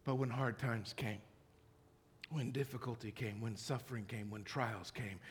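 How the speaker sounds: usual speaking voice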